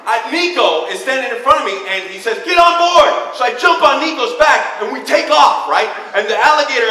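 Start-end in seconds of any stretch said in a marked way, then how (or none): 2.4-3.1 loud high call to climb on; mock command. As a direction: shout the order like a steed. Creature commands rider.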